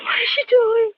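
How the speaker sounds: high, sobbing frightened question; wife watches the figure in the dark, crying in fear; crying in terror. Acted. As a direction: ask it through tears of fear.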